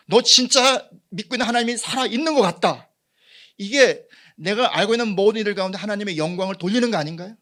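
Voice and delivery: loud, high fervent preaching; preaching at full force. Acted. Pastor exhorting the congregation, loud and high. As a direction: preach it with rising fire.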